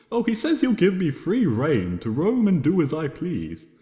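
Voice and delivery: usual speaking voice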